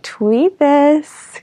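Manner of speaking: playful high chirp. Acted. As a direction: chirp it like a little bird, jokingly. Joking about birds around her.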